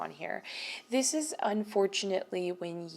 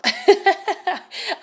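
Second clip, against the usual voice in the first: high laugh breaking through tears; tearful laugh; 12 semitones higher, 13 dB louder than usual. Real, not acted. Laughs through tears mid-reading.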